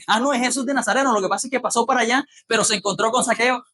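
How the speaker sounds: fast, eager bystanders' reply; quick eager answer. Retold or imitated. Bystanders telling the blind man, quick and eager. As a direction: rattle it off like eager townsfolk.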